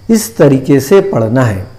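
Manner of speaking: normal talking voice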